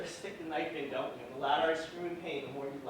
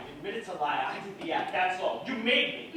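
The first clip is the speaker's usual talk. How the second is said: loud, rising furious blame; loud angry outburst, voice climbing; shouted blame; 2 semitones higher, 7 dB louder than usual. Acted. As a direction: throw the blame back at her. Shouting blame back at her.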